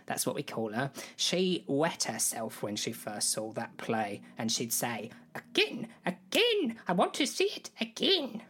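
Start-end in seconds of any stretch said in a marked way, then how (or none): 5.3-8.4 very high, squeaky queen impression; mock royal squeal; imitating the Queen. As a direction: pipe it high, demanding an encore. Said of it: "That's how she talked".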